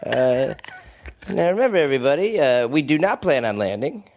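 low, lazy pilot intercom; deadpan captain on the PA; airline pilot announcement. Pretend.

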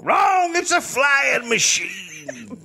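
slow, drawn-out triumphant answer; old-timey witness. Pretend. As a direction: savour the answer slowly. Balloon guy answering.

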